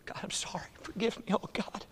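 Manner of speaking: hushed, broken plea; whispered confession. Retold or imitated. Peter confessing to the Lord, softly whispered plea. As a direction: whisper it like a man on his knees.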